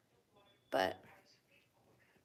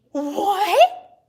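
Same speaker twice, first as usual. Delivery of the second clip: loud, high shocked "What?"; shocked shout; 8 semitones higher, 16 dB louder than usual. Retold or imitated. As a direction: blurt it out in disbelief. Shocked reaction to toothless player.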